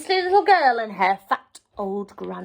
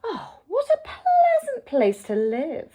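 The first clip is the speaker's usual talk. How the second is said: higher, soft fake-pleasant coo; soft wolf; 8 semitones higher than usual. A narrator's reading. Wolf pretending pleasant interest, higher and soft. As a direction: purr it pleasantly as the sly wolf. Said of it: "soft voice".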